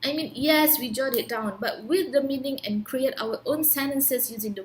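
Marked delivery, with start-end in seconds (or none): none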